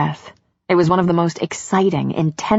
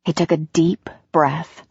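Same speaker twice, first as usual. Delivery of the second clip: ordinary delivery, nothing marked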